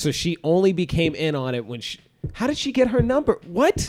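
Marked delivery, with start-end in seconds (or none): 2.3-3.9 high incredulous. Real, not acted. Confused friend demanding an answer.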